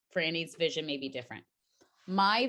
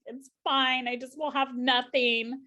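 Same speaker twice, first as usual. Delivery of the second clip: mock martyr; 6 semitones higher, slower than usual. Quoting her own dramatic resignation.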